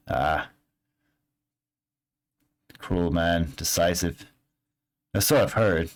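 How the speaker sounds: hint at dark secrets, fishing for payment; ominous local NPC. Acted. NPC lore-telling ominously.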